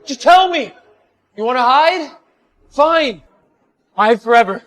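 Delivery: high, loud, exasperated demand; angry shouting. Acted. Man challenging the woman hiding from him, angry shouting. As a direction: yell at someone who won't show themselves.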